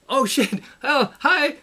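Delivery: high startled "oh" that turns meek; imitating a guy thrown by friendliness; the tough guy suddenly taken aback; caught off guard. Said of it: "I'm like".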